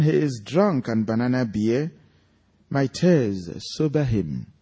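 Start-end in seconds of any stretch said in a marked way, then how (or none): none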